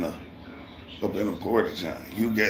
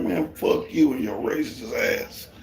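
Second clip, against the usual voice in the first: slow, higher, deliberate cussing; slow angry cuss-out; 9 semitones higher than usual. Retold or imitated. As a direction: spit each word at him slowly. Cursing out the boss.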